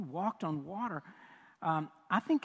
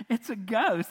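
raised, louder mock alarm; terrified cry; 5 semitones higher, 7 dB louder than usual. Preacher retelling the disciples' fright on the water, mock terrified cry. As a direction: play a frightened disciple calling out. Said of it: "they're just terrified".

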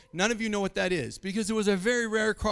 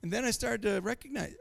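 ordinary talk

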